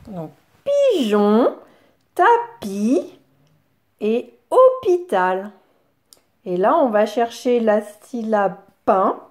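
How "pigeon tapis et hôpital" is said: "pigeon, tapis et hôpital" with syllables drawn out; slow, separate beats on "pigeon, tapis et hôpital". Shown as a demonstration. Teacher having children hunt for pi, "pigeon, tapis et hôpital" syllabified. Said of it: "On va chercher la syllabe pi".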